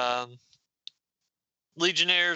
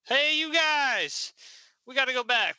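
mock loud yell; 10 semitones higher than usual. Laurel calls out to the Legionnaires, a mock loud yell. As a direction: shout to the team, hammed up but not at full force. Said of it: "she yelled so loudly".

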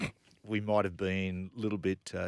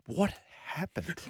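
dazed morning-after; 8 semitones higher, slower than usual. Retold or imitated. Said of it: "I went".

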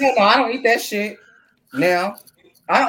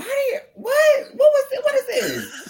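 shocked laugh, pitch way up; laughing disbelief. Real, not acted. Caught off guard by a friend's question, laughing high disbelief.

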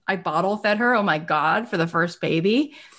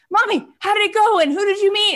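put-on eager child voice, much higher and louder; kids' voices; 13 semitones higher, 4 dB louder, faster than usual. Kids greeting mom in the carpool line, high eager child voices. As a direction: play the kids asking about her day.

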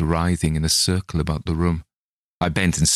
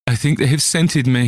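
higher, louder terrified cry; shriek; 8 semitones higher, 4 dB louder than usual. Acted. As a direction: cry out in panic. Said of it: "he shrieked".

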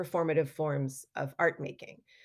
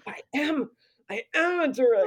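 higher, a startled dawning; excited realization; 6 semitones higher than usual. Retold or imitated. Realizing she is a director, excited and startled. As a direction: it finally clicks for her. Sudden realization after a gasp.

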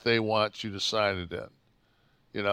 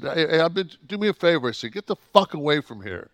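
raised, heated retold telling-off; angry brush-off, moderately raised; retold yelling; 6 semitones higher, 5 dB louder, faster than usual. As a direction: retell chewing out a pushy guard. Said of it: "hear me scream at this motherfucker".

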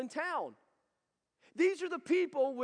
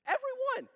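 "Every one" jumps much higher, each word hit; emphatic stress; 12 semitones higher, much faster than usual. Acted. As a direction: lift "Every one" high and punch it. Preacher stresses all were healed.

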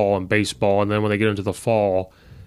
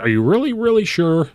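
skeptical challenge; 7 semitones higher, 3 dB louder than usual. Retold or imitated. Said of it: "I was like".

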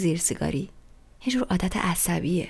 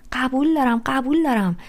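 louder, higher, hurried agreement; insistent, exasperated agreeing; impatient concession; 5 semitones higher, 6 dB louder than usual. A narrator's reading. Impatiently concedes Sally's point.

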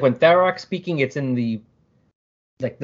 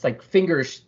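ordinary delivery

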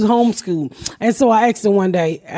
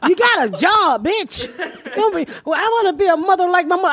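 mock rant; 6 semitones higher than usual. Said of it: "I was like".

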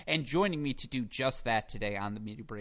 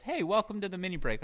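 higher, innocent intro; alto pitch; 8 semitones higher, faster than usual. Retold or imitated. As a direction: sing out the greeting, pitched up. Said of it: "I also hit the alto pitch".

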